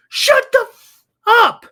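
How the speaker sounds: high, loud, slow mock heckler shout; stretched-out shouted put-down; imitating a yell. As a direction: belt it out like the rude stranger on the mic. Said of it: "they're yelling".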